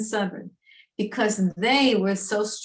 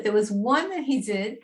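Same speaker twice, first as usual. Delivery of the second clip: ordinary delivery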